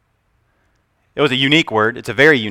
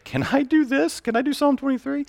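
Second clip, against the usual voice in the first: imitating himself asking; 12 semitones higher, 9 dB quieter than usual. Asking his pastor for permission.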